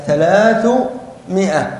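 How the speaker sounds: ثلاثمائة drawn out slowly, syllable by syllable; teacher slowly sounding out ثلاثمائة for the class; slow spelled-out word. Shown as a demonstration. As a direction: stretch out ثلاثمائة slowly so each sound is clear. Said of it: "لاحظوا معي كلمة مئة".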